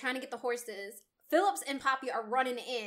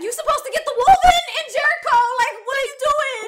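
much higher and louder, fast and laughing; hammed-up outrage at the wrong shot; outraged disbelief; 12 semitones higher, 14 dB louder, faster than usual. Voicing Poppy's outraged disbelief.

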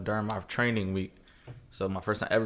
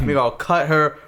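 loud, higher mock Jamaican threat; Jamaican accent; 6 semitones higher, 9 dB louder than usual. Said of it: "And then she Jamaican".

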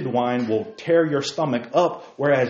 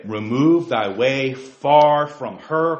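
"Remove thy way far from her" drawn out slowly, word by word; slow scripture reading; 3 dB louder, much slower than usual. Real, not acted. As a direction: read it slowly, let each word land.

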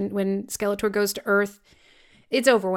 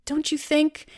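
pleading villain; 5 semitones higher than usual. Retold or imitated. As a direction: coax him like a lonely tyrant. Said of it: "he's like".

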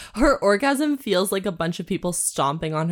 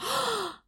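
sharp, high intake of breath; excited gasp; 9 dB quieter than usual. Real, not acted. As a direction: gasp at a sudden idea. Reacts to a new idea.

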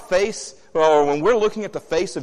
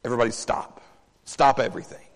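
frustrated Jesus; 3 semitones lower, faster than usual. Retold or imitated. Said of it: "I see a frustrated Jesus going".

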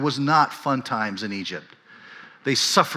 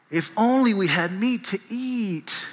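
high, slow whiny plea; 9 semitones higher, slower than usual. Shown as a demonstration. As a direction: whine it like a spoiled kid. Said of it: "That little whiny voice".

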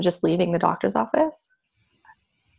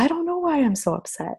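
put-on higher, softer child's voice; daughter's upset voice; 8 semitones higher, 4 dB quieter than usual. Daughter after leaving, shaken and confused, higher softer voice. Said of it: "she's like".

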